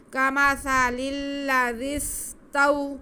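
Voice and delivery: استوى with the waw not stretched, chanted slowly and high; don't drag the و of استوى; slow recitation, waw kept short; short waw leen. Shown as a demonstration. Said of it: "واو ملین، اس کو کھینچنا نہیں ہے".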